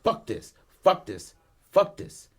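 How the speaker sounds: retold outburst climbing in pitch; mock fit of rage, jumping high at the end; irate. Son smashing up the car, irate and rising. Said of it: "like, irate".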